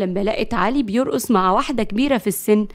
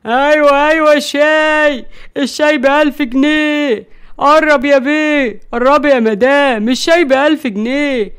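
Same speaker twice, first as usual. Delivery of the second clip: street tea seller; 3 semitones higher, 7 dB louder than usual. Acted. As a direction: hawk your wares to the crowd. Tea seller hawking to passers-by.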